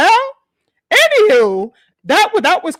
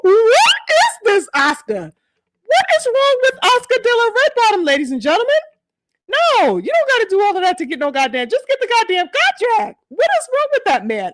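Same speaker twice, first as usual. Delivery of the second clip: pitched-up exasperated outcry; outraged disbelief; 11 semitones higher than usual. Real, not acted. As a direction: call him out in disbelief. Host ranting at Oscar's comment.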